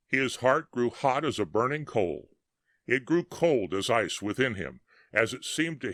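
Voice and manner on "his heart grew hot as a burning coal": usual speaking voice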